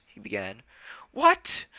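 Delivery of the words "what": high, loud shocked cry. Acted. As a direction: gasp it out in shock. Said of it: "Smudge was clearly shocked".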